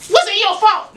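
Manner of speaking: high-pitched mock mama outburst; mother's urgent question. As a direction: fire it back high as an alarmed mother. Voices mother's reaction on phone.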